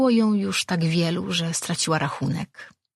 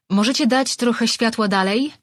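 raised voice calling down a dark tunnel; 6 semitones higher, 4 dB louder than usual. Acted. Rejwan calls back to the servants, calling out. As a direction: call it ahead so they hear you. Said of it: "zawołała".

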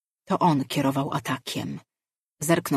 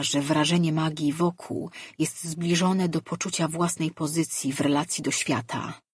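plain reading voice

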